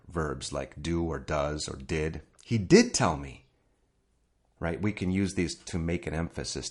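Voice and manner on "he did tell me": emphatic DID, falling away after; stress on DID. Shown as a demonstration. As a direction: lean hard on the word "did". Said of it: "emphasize in English by using auxiliary".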